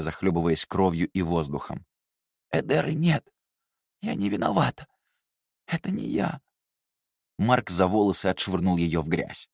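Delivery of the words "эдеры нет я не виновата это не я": frightened plea. Acted. Beaten girl pleading innocence.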